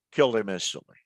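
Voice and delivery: ordinary talk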